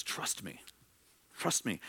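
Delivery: tender hush. Retold or imitated. Repeating Jesus' words softly.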